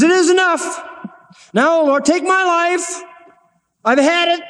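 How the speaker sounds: high, strained plea to be taken; the old prophet begging God to end it, voice pitched high; weary despairing prophet. Acted. Elijah pleading with God to take his life, strained and high.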